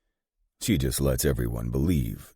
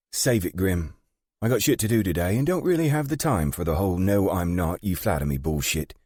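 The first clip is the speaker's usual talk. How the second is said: higher-pitched, brisk and impatient Margaret; brusque British gang boss; 7 semitones higher, 4 dB louder than usual. Acted. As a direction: cut him off in a high, no-nonsense voice.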